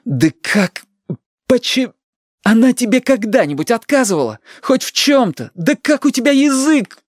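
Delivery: high-pitched, choked outrage; acted enraged grandson; indignant shouting. A narrator's reading. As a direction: fire off appalled questions at grandma. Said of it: "Перестань же кричать".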